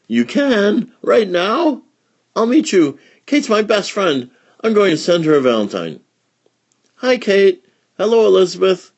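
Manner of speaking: high, loud, eager greeting; light up at seeing your best friend; excited girls. Acted. Elizabeth meets her best friend Kate, excited.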